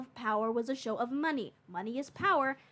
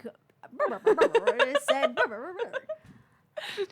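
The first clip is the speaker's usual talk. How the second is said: laughing, higher, louder brr chopping; mock glitchy soundbite; 9 semitones higher, 6 dB louder, slower than usual. Mocking how a clipped soundbite would repeat itself, laughing. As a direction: stutter it like a glitchy clip while laughing. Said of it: "soundbite that and be like".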